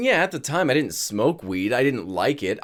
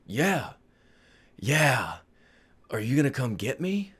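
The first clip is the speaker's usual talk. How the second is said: groggy waking dad; 4 dB quieter than usual. Retold or imitated. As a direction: repeat it softly, with long gaps. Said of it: "my father does wake up".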